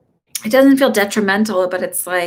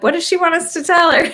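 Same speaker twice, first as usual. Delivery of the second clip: high, louder incredulous question; retold mock exasperation; 9 semitones higher, 5 dB louder than usual. Spirits answering a client wanting reassurance, mock exasperated. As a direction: throw it up high, as if baffled by the demand.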